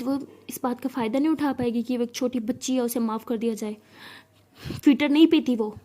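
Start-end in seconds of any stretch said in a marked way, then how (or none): 4.8-5.7 loud furious snap; angry outburst. A narrator's reading. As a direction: spit it out in rage. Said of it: "गुस्से के मारे पागल". (गुस्से से तेज़ आवाज़ में)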